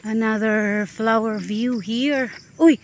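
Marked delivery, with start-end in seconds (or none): 2.6-2.8 loud, high cry of dismay; alarmed, raised voice; startled exclamation. Real, not acted. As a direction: exclaim at a sudden discovery. Exclaims on seeing burnt grass.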